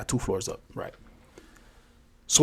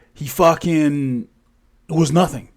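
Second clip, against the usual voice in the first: plain reading voice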